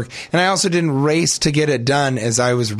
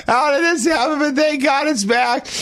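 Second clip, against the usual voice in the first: shouted far higher, overjoyed and laughing; screaming elation; slower than usual. Pretend. Man whose lost part returned, screaming with relieved joy. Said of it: "screaming would turn to elation and joy".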